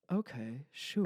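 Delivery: soft, hesitant agreement, lifting at the end; tentative. Retold or imitated. Agreeing to a date's park invitation, tentative. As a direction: imitate an unsure, reluctant yes. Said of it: "tentatively like".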